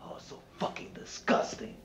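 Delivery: disgusted whisper. Acted. Disgusted terrorist rant, whispered.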